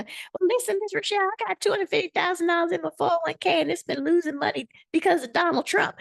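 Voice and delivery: high, fast mock attendee voice rattling off a complaint. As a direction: parody an oversharing caller, high and fast. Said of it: "So you can't go".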